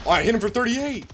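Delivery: loud, high, thrilled yell; excited shout. Real, not acted. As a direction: shout it out over a big hit. Big hit in the game.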